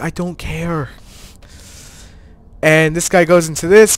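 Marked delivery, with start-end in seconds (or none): 0.0-0.9 muttered dismissal. Retold or imitated. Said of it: "I'm like".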